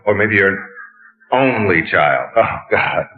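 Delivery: low-pitched mock commiseration; mock sympathy. Mimicking psychologists excusing a patient, low mock sympathy. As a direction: sink low, as if pitying a hopeless case.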